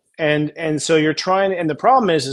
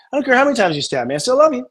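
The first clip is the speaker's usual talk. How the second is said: defiant love; 8 semitones higher, faster than usual. Pretend. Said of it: "from the part of you".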